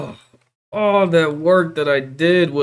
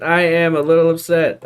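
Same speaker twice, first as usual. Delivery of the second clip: usual speaking voice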